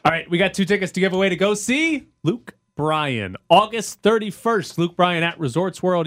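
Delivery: high, loud upbeat radio announcer; radio contest promo. Acted. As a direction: pitch the giveaway like a DJ. Host reads ticket giveaway.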